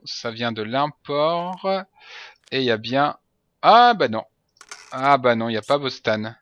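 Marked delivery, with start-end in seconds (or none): 3.6-4.2 higher, louder sudden catch; sudden surprise. Real, not acted. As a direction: yelp as you spot the error. Spots an error in passport.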